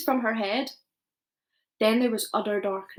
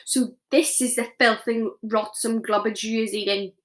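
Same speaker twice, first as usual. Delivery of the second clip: usual speaking voice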